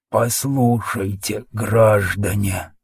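slow, soft lisping wheeze; slushy sh for s, dying breath; lisping whisper. A narrator's reading. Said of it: "тихий шепелявящий голос".

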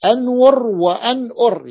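careful teacher demo of the hamza form; showing the two forms. Shown as a demonstration. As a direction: spell out أنور and أنؤر slowly, the second with a glottal stop. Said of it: "لذلك قال هنا".

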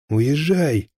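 high, urgent cry to leave; shouted command. A narrator's reading. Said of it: "крикнул Звягинцев".

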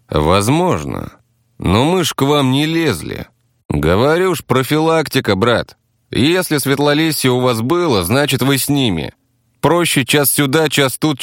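defensive taxi driver. Acted. Taxi driver explains the attack as prevention, defensive.

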